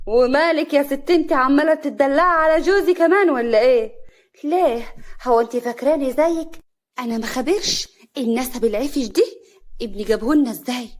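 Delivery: loud, jealous scolding. A narrator's reading. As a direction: lash out at a rival. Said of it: "نوال بغيرة". (بغيرة وعصبية)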